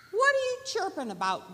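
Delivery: quick, high, loud squirrel voice; challenging squirrel. Acted. Squirrel questioning the singing bird, challenging.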